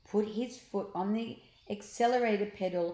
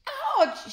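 ouch; 8 dB louder than usual. Acted. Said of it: "she cried".

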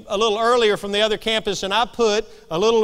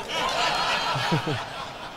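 laughter; 7 semitones lower, 8 dB quieter than usual. Real, not acted. Laughs at own punchline.

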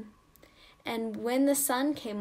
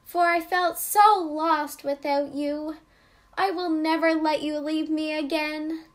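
put-on a little girl's voice, higher and louder, full of relief; Marcella; 5 semitones higher, 6 dB louder than usual. Marcella telling her doll she won't let her go, childlike and relieved. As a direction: hug the words close like a child holding her doll. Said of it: "said Marcella".

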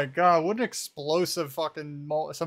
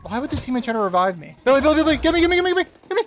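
high panicked shouts to a teammate; frantic yelling; 10 semitones higher, 6 dB louder, faster than usual. Real, not acted. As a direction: yell for help in panic, much higher and louder.

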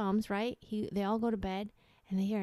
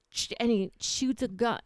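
ordinary talk

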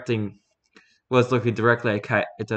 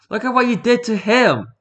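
much higher, louder outburst; furious priest shouting at the oni; angry shout; 13 semitones higher, 6 dB louder than usual. Acted. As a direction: yell it in outrage.

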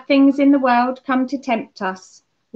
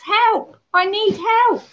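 higher, mock call for help from a stuck child; imitated cry for help; 10 semitones higher, slower than usual. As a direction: call out as if stuck up a tree, lightly hammed-up. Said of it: "So he shouts".

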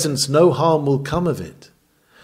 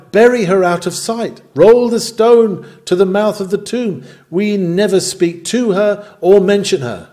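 louder, higher voicing of the crowd's cry; mock outcry; 6 semitones higher, 6 dB louder than usual. Preacher voicing society's cry against a fallen woman, louder and higher. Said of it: "they cry".

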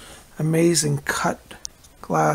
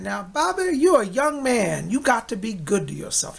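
put-on higher, slower old Southern gentleman accent; a fond Southern accent, higher and unhurried; Uncle Alvin's Southern drawl; 5 semitones higher than usual. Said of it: "He had a Southern accent".

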